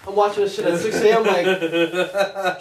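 loud, high, fast and thrilled; bursting with excitement, almost laughing; excited. Real, not acted. Excited retelling of watching show.

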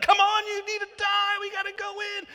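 high, loud exasperated yell to die already; impatient yelling. Retold or imitated. Israelites at the grumbler's tent, yelling for him to die. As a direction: urge the dying man on, shouting. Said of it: "They're like".

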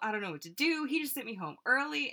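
hammed-up panicked gush; imitating panic. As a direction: play a flustered friend freaking out on the phone. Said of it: "so panicked".

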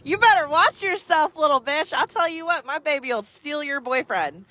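put-on high, laughing gloat at the rival girl; laughing taunt. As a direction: crow it through laughter, mockingly proud.

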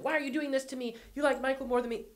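high, whiny imitation of a wounded mother; mock victim voice. Mother guilt-tripping her daughter, in a mocking high victim voice. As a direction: play the hurt victim, pitched high. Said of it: "she's the victim".

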